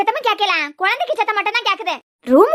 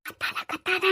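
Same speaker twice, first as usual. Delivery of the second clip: much softer urgent hush; hushed warning; 9 dB quieter, slower than usual. Acted. Hushing child during hiding. (குழந்தையை மெதுவாக அதட்டுதல்)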